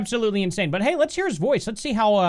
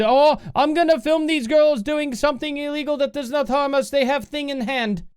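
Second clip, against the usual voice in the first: mocking the filmer; 7 semitones higher than usual. Mocks the man filming.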